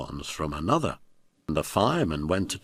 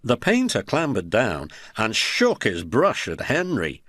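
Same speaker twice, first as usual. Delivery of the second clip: plain reading voice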